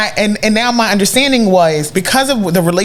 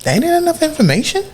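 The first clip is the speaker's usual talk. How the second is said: incredulous inner voice; 9 semitones higher than usual. Retold or imitated. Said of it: "my woman brain thought was".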